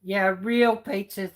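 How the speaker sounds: ordinary talk, nothing marked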